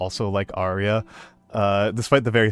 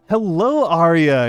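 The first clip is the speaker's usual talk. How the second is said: higher, louder delighted hello; light up as a friend walks in; Hello rising high, Arya called out happily; excited greeting; 6 semitones higher, 7 dB louder, much slower than usual. Real, not acted.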